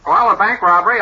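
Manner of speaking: "Well" punched high and loud; newsreel well. Shown as a demonstration. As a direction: hit "Well" like a newscaster. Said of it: "I always use the term well".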